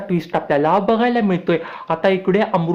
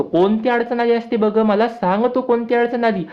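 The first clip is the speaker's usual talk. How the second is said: put-on pitched up for the young boy Abir; eager small boy, voice raised high; little boy Abir; 6 semitones higher than usual.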